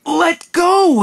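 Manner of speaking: put-on high, loud cry of a frightened boy; tearful shout to let go; desperate yell. A narrator's reading. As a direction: yell it out, pulling free. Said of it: "he yelled".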